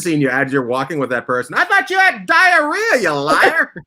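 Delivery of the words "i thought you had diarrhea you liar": high, slow mock accusation with a laugh; mock accusing friend. Friend catching her in a lie, high mock accusation. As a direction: tease like a friend who caught you lying.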